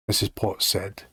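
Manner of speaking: usual speaking voice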